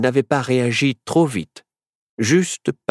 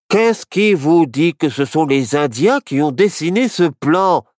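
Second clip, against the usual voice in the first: exclaimed; 5 semitones higher, 4 dB louder than usual. A narrator's reading. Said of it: "s'exclama Barnabé".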